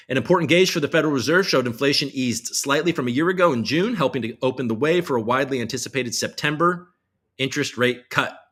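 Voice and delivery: reading the news like an anchor. Acted. Streamer reads a news headline to viewers in anchor style.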